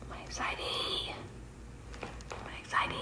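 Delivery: hushed nervous whisper. Real, not acted. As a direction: breathe it out in a whisper.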